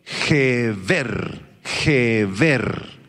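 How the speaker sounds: Geber said slowly, weight on the final -ber, falling; Geber, Geber: land the stress on the second syllable. Shown as a demonstration. Preacher teaching the congregation the Hebrew word, stress on the second E. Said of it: "con acento en la segunda E".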